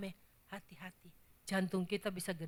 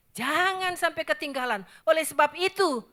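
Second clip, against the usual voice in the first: higher, louder urgent plea; urgent warning; 7 semitones higher, 8 dB louder than usual. Real, not acted. Preacher urging the congregation not be left behind, loud. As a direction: warn them not to miss it.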